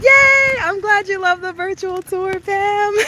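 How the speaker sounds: high, delighted thanks after a loud yay; happy cheer. Real, not acted. Streamer to viewer enjoying the virtual tour, delighted. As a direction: cheer loudly, then stay high and pleased.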